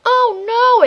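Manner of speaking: high loud oh no; dismayed yelp. Real, not acted. Reacting to hitting herself.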